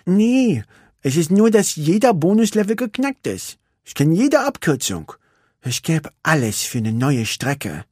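sulky little racer; Vanellope. A narrator's reading.

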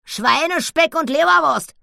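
high, loud exclamation; exclaiming. Acted. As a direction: blurt it out with glee. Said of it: "rief Annabella". (laut und hoch ausgerufen)

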